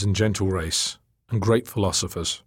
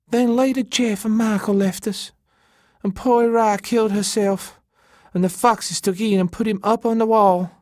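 very high, distressed woman's voice; on the verge of weeping; 12 semitones higher, 4 dB louder than usual. A narrator's reading. Elena recounts who left them, high and tearful. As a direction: lament it, close to tears. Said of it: "She suddenly started to weep".

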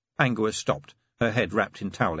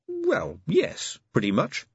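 grudging concession; 11 semitones higher, slower than usual. A narrator's reading. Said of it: "Angua conceded".

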